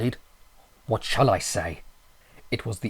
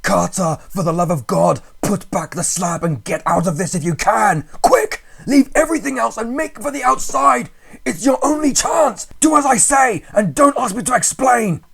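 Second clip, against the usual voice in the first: high, loud, frantic commands; wild consternation; 13 semitones higher, 10 dB louder than usual. A narrator's reading. Friend urging narrator to flee in wild panic. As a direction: order him out in panic. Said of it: "in a pitch of wilder consternation".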